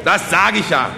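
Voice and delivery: loud, high oratory; triumphant agreement. Acted. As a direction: seize the cue and proclaim it. Orator triumphantly makes his appeal. (triumphierend laute Rednerstimme)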